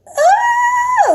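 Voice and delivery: very high drawn-out scream; re-enacted freak-out scream. Speaker when the call dropped, freaking out scream. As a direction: squeal in mock panic. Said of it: "Like, freaking out even more".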